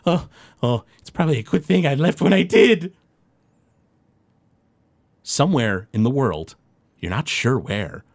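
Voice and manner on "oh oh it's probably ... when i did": put-on character voice, climbing high; Professor von Drakovich. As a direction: start with a chuckle, lift the pitch into the remark. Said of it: "you see Professor von Drakovich".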